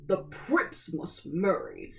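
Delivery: slow and lordly, a king laying down the law; king ordering his duke. A narrator's reading. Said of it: "said the king to the great Grand Duke".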